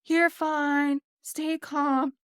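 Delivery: imitating a cheery attendant calling up; mock yelled reassurance. Attendant calls up the tube to the panicking kids, mock yelled reassurance. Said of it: "And he's yelling".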